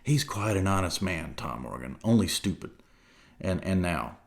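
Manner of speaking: soft, low, hushed aside; confidential whisper. A narrator's reading. Silver confiding to Jim in a hushed voice. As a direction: lean in and murmur secretly. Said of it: "in a confidential whisper".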